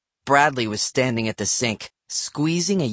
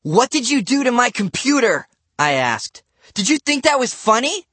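accusing; 9 semitones higher, 3 dB louder than usual. A narrator's reading. Angry boy confronting neighbor.

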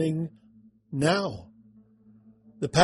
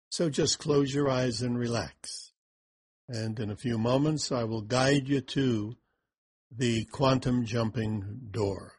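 guided meditation; 4 dB quieter than usual. Acted. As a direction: lead them gently into calm.

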